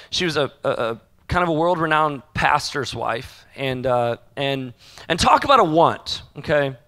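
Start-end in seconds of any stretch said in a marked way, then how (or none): none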